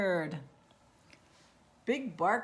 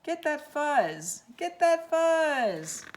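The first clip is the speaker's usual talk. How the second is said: slow, rising chant that builds to a yell; building shout; 6 semitones higher, 5 dB louder, slower than usual. Acted. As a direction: egg the crowd on, louder each time. Prairie dogs urging the grab.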